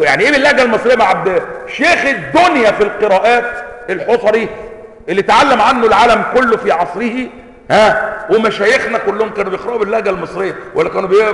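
loud, high, incredulous challenge; indignant rebuttal. Acted. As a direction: argue back loudly and indignantly. Indignant rebuttal of accent complaint.

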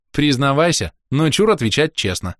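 high teasing demand; playful teasing. Acted. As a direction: tease him into confessing. Кира поддразнивает Роса. (игривое требование)

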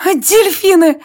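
high delighted squeal. A narrator's reading. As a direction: squeal it out with joy. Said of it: "взвизгнула от восторга Варя".

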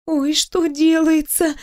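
high, drawn-out mental wail; inner cry. A narrator's reading. Employee panicking as boss comes close, crying inwardly. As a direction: draw it out high in alarm. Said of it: "мысленно завопила я".

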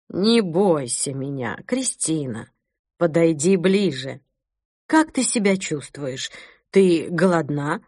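soft, slow, low bishop's voice; quiet, ingratiating. Acted. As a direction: purr it gently, too sweet to trust. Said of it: "Тихий, вкрадчивый голос Конрада".

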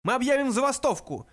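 high, loud threat; wailing yell. A narrator's reading. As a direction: scream it as an outraged threat. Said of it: "завопил Врумфондель".

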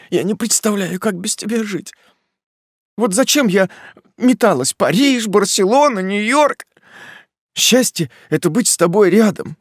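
high, loud tearful pleading woman; weeping young woman. Acted. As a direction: pour out love through tears.

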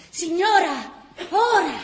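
high, loud urgent call. Acted. Old man calls Gina to cross, loud urgent call. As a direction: call her across now.

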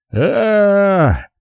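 high, drawn-out yell; drawn-out "aah" battle cry; high holler without extra volume. A narrator's reading. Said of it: "заорал я".

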